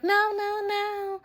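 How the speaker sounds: high-pitched imitated scream of protest; mock frantic scream. Friend protesting the cousin's call, mock panicked high scream. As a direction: mimic her panicked no, way up high. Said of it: "She's screaming and shit on the phone".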